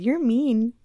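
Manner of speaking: high, mock-whiny kids saying you're mean; lightly voiced schoolkids teasing; a hint of a taunting child. Kids mocking her bluntness.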